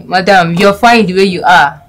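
usual speaking voice

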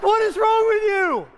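high loud incredulous cry; playfully exasperated at his wife; mock outrage. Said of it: "Mindy, aren't you moved by that".

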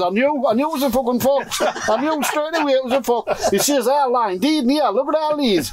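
high, agitated retelling of the neighbour; worked-up neighbour. Retold or imitated. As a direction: raise it up like a riled old man over his hens.

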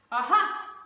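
loud, sudden exclamation; triumphant cry. A narrator's reading. Dingo springs his plan, loud triumphant cry. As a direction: burst out with it loudly. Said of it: "cried Dingo".

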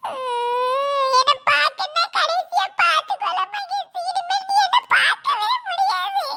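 long whimper into a tearful wail; crying. Acted. Child telling Mahesh it's goodbye, crying. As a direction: sob out a last farewell. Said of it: "அவ எதுக்கு அழுதுட்டு இருக்க?".

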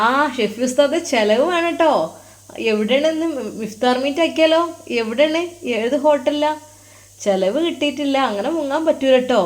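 high, jokey group-of-friends voice; teasing colleagues. Acted. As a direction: playfully demand a treat from a friend. Group of colleagues teasingly demand a treat.

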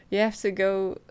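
ordinary delivery

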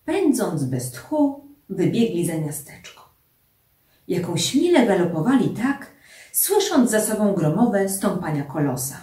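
ordinary delivery

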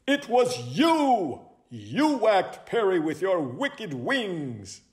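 high, slow accusing cry; dramatic accusation. Acted. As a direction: point the blame with drawn-out drama. Owl accuses a suspect.

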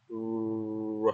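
"R" with pursed lips and curled tongue; rounded American R. Shown as a demonstration. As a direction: demonstrate the sound slowly. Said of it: "Chu môi ra". (âm R kiểu Mỹ, chu môi)

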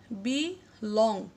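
বি লং in two very slow, separate syllables; long pause between বি and লং. Shown as a demonstration. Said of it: "দুটি syllable পাই". (বি লং, ধীরে ভেঙে ভেঙে)

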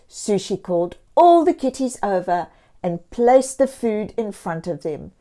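ordinary delivery

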